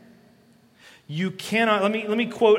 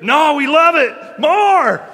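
loud, high, slow shouted refusal; excited refusal; 9 semitones higher, 12 dB louder, slower than usual. Retold or imitated. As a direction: shout it back like a thrilled group that won't split. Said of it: "I'm begging them".

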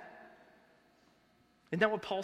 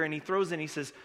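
ordinary delivery

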